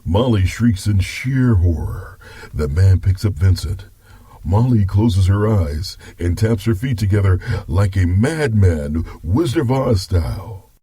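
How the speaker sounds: story narration. Acted. Grandpa narrates.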